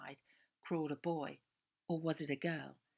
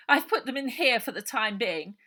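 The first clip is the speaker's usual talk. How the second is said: sugary, higher and much louder scheming woman; honey-sweet voice hiding malice; treacly Missus Buller; 7 semitones higher, 14 dB louder than usual. A narrator's reading. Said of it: "in her treacliest voice".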